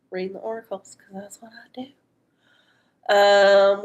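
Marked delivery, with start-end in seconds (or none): 0.1-1.9 hushed aside; whispering. Real, not acted. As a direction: whisper it to yourself. Whispered aside while reading oracles.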